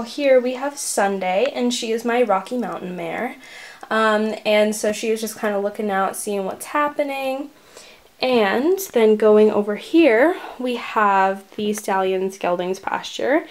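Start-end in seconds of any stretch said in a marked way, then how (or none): none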